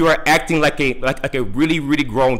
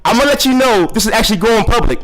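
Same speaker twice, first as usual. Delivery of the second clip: raised pitch, indignant; indignant warning; 6 semitones higher, 3 dB louder than usual. Real, not acted. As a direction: put them on notice, pitched up in indignation. Indignant caller warns of going public.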